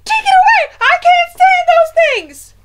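shrieking, very high and very loud; panicked yelling. A narrator's reading. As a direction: scream in horror at a mouse.

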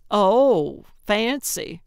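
mock-impressed admiration. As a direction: exclaim as if at a great luxury. Gertrude admiring the cup holder.

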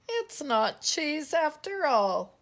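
loud, high mock-tearful complaint; sniffing disappointment. A narrator's reading. Pip finding the nose is no cheese, sniffing disappointment. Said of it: "sniffed Pip".

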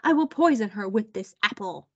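outraged queen. A narrator's reading. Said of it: "yelled the queen".

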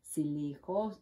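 plain reading voice

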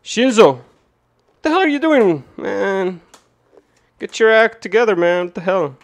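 high, loud put-on telling-off; mock scolding. Streamer telling off an imagined card maker, mock-angry shouting. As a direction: holler at him, dragging out the complaint.

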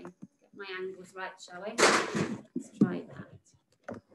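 very soft murmur fading to a whisper; barely audible, talking to oneself; muttered aside. Real, not acted. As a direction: mutter to yourself while fiddling with the camera. Aside while adjusting the camera.

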